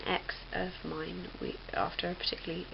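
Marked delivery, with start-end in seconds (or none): none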